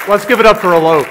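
loud host call for applause; rally the audience for the coach. Real, not acted.

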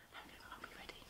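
soft whispered instruction; hushed aside. Shown as a demonstration. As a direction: whisper the next step.

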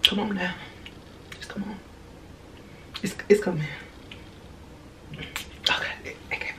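barely audible coaxing murmur; under the breath. Real, not acted. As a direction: coax it along almost in a whisper. Coaxes under breath during spraying.

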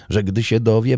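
ordinary delivery

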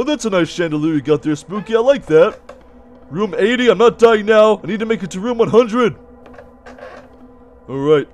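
ordinary delivery

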